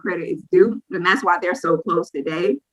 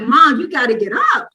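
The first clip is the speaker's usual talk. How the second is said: higher-pitched insistent plea; urgent waking call; 6 semitones higher, 4 dB louder than usual. Retold or imitated. Daughter waking her mother for the crying baby, insistent call. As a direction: nag a sleeper out of bed.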